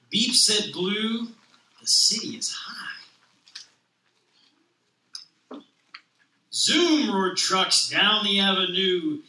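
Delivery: lively picture-book read-aloud with voiced characters; reading to children. Stay-at-home dad reading a children's book aloud, animated. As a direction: read it like storytime on the carpet.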